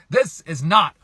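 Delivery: a touch of exasperated protest; mildly frustrated. Retold or imitated. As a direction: lightly re-enact fed-up frustration. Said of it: "I'd be really frustrated".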